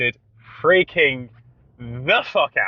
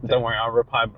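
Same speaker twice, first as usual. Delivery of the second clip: usual speaking voice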